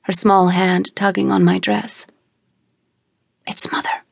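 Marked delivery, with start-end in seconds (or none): 3.5-4.0 soft hushed whisper; girl whispering to her cousin in the hall. A narrator's reading. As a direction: whisper so the parents can't hear. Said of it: "I whispered".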